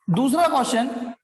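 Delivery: usual speaking voice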